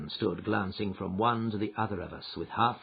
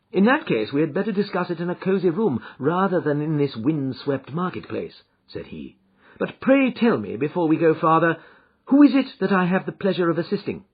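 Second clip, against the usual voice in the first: Holmes, calm and assured; 7 semitones higher, 6 dB louder than usual. Acted. Holmes's voice in narration.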